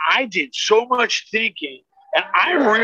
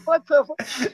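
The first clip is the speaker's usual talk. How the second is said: shocked; 7 semitones higher than usual. Retold or imitated. Shocked at friend's door.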